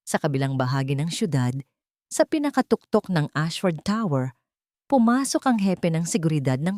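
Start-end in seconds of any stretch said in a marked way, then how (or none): none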